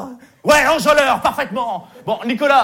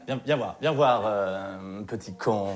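menacing low murmur; 12 semitones lower, 8 dB quieter, slower than usual. Acted. Camp counselor menacing a kid.

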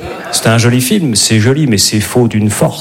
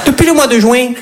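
put-on much higher, sing-song Midi accent; southern French accent; 10 semitones higher than usual. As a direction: play a rom-com couple with a Midi accent. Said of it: "avec l'accent du Midi".

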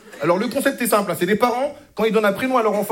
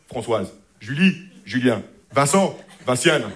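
lower, slow, mock-refined roll call; mock posh name list; 9 semitones lower, slower than usual. Shown as a demonstration. Comedian mocking French paired names, low and slow. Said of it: "le système des François".

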